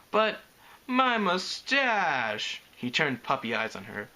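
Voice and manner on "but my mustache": put-on high, drawn-out childish plea; whining protest. A narrator's reading. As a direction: plead like a sulky kid, stretching the last word. Child dragon pleading for his moustache.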